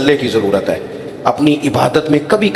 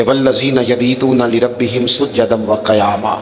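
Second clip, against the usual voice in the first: Quran recitation; 4 semitones lower, slower than usual. Acted.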